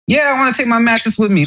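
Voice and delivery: mock-indignant reply, pitched high; indignant retort. Customer answers the mover about the mattress, indignant.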